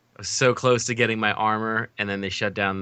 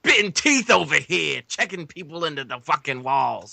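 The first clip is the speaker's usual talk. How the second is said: hyped hockey hollering; 4 semitones higher than usual. Pretend. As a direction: holler it like a rowdy hockey fan. Hyped riff on hockey season starting.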